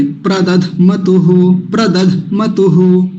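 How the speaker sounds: प्रदध मतुहु slowly, with a slight jolt before मतुहु; प्रदध मतुहु drawn out, मतुहु stressed; slow syllable drill. Shown as a demonstration. Teacher modelling a slow Sanskrit drill. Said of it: "यहाँ थोड़ा सा झटका लगेगा".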